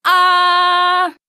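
loud, high, held "aah"; wide-mouthed "ah". A narrator's reading. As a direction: open wide and belt out a steady aah. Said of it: "opens his mouth wide".

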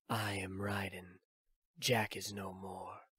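slow, soft near-whisper with no feeling; empty and cold, every word slow; emotionless. Acted. The young cyborg tells Snake his old name is gone, emotionless and hushed. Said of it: "The voice was emotionless".

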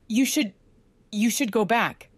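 start, falter, then finish wearily; exhausted voice. A narrator's reading. Patient urges friend to go back, exhausted voice. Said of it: "in an exhausted voice".

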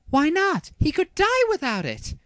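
very high-pitched protest; alarmed exclamation. A narrator's reading. Heiba to Seto about delayed surgery, high alarmed exclamation. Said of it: "Heiba exclaimed".

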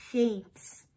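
usual speaking voice

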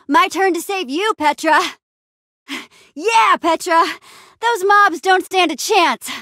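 high, excited cheering; battle cheer. Acted. Adventurer saving a friend from mobs, battle cheer.

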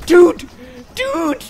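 high, loud panicked yelling; shrieking in real panic as he is shot at; panicked screaming. Real, not acted. Player shrieks at guards shooting at him, panicked and high.

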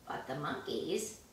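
usual speaking voice

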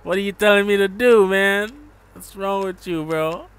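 loud, high, hammed-up protest; mock indignation. Jamaican streamer scolding a viewer, mock indignant. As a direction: burst out in playful outrage at the demand.

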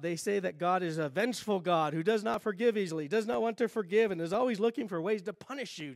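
ordinary delivery

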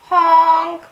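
high, loud mock horn; imitated car honk. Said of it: "sounded like the Roadrunner".

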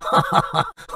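breathy high taunting cackle; sneering burst of laughter; mocking laugh. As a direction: laugh in her face. Thug mocks the old woman.

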